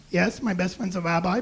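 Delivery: ordinary delivery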